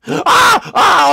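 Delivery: a high, loud mock scream; faked high-pitched scream; pretend scream. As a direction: shriek loudly as if frightened, but pretending. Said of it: "I'm just gonna pretend like I, I'm screaming".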